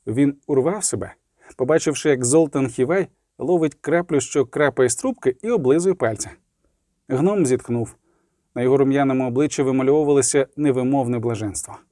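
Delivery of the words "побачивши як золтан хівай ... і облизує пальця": plain reading voice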